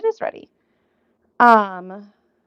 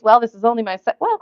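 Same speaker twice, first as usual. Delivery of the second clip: ordinary talk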